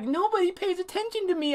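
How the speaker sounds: high, thin put-on girl voice; imitated little girl. Acting out the ghost girl's complaint.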